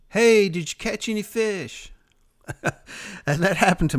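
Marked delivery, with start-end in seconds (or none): 0.1-1.9 yelling from shore. Retold or imitated. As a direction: call out across the water like someone yelling from shore. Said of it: "some guy over there yelling".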